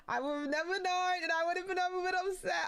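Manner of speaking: high-pitched protest; upset complaint. Real, not acted. Complaining to friend about news.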